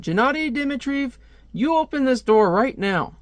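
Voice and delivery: high-pitched stern demand; scolding parent. Acted. Parent outside a locked bedroom door, high scolding demand. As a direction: order the child out in a high voice.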